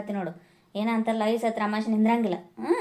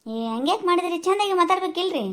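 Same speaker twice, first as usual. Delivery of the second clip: put-on higher voice of the young daughter-in-law; a different, higher woman's voice asking; 7 semitones higher than usual. Daughter-in-law gently scolds.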